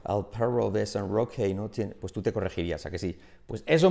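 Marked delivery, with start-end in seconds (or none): 0.1-1.5 put-on slow Spanish with a heavy English accent; exaggerated English-accented Spanish tongue-twister. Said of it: "se te pone un acento inglés".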